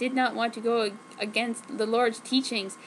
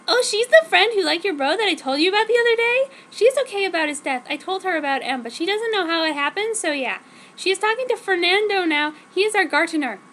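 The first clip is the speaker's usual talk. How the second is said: put-on high, louder teen girl voice; girly heroine voice; 6 semitones higher, 5 dB louder than usual. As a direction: play the gushing teenage heroine. Narrator voices herself in quotes.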